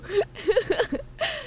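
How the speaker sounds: high-pitched fit of giggles; teasing laughter. Real, not acted. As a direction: giggle high at someone's bad luck. Laughs at viewer being hated.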